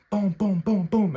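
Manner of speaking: rapid-fire booms for blows landing; imitated punch sounds. Man recounting a brawl to viewers, imitated blows.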